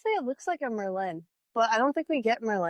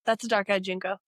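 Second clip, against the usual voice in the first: ordinary talk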